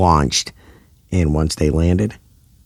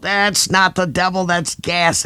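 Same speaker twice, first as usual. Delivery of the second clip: yelled; 12 semitones higher than usual. A narrator's reading. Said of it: "Rod yelled".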